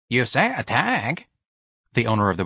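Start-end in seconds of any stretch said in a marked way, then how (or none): 0.1-1.3 high, slow drawl; a lazy worker answering; factory worker. Acted. Chain owner answers.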